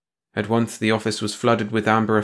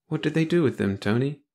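lightly voiced scared woman, higher; small, fearful question; frightened girl; 6 semitones higher than usual.

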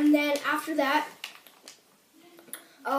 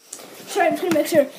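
lisp from braces; 3 semitones higher, 8 dB louder than usual. Real, not acted. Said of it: "sorry about my lisp. I got braces".

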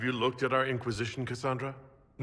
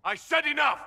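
much higher and louder command; furious shout; 5 dB louder than usual. Acted. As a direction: bellow to end it now. Shouting down the quarrel.

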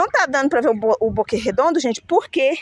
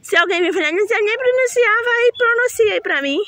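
playful don't care; 9 semitones higher than usual. Real, not acted. Playful defiance about pronunciation.

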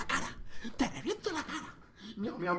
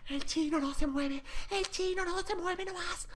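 higher, softer, slow squeaky voice; mock high voice; 10 dB quieter than usual. Streamer teasing a motionless stranger on camera, soft squeaky mock voice. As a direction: creep it out in a tiny high voice.

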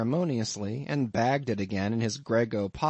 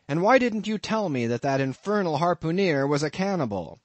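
indignant; 6 semitones higher, 4 dB louder than usual. A narrator's reading. Narrator berates landlord.

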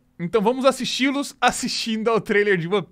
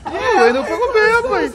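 mock excited; 11 semitones higher, 7 dB louder than usual. Real, not acted. Laughing at a failed kick.

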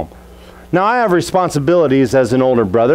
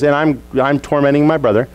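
ordinary talk

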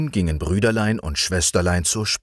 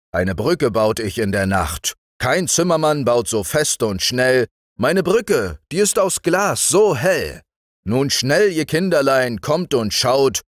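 laut und gereimt rufend; fröhlich die Kinder wecken; weckender Ruf; 5 semitones higher, 4 dB louder than usual. A narrator's reading. (Winter ruft die Kinder am Fenster laut wach)

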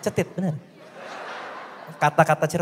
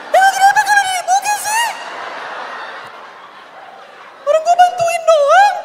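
mock offended; 4 dB louder than usual. Comic mock-offended high voice.

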